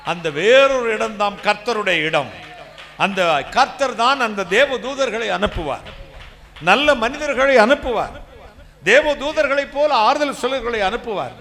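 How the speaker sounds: loud, rising proclamation of the Lord's help; full-force preaching. Acted. Preacher proclaiming to the congregation, full-force preaching. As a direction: preach it out to the whole hall.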